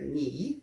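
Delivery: "ni" descendo e subindo no terceiro tom; faça o "ni" com tom que desce e sobe. Shown as a demonstration. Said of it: "ele tem o terceiro tom". ("ni" no terceiro tom do mandarim)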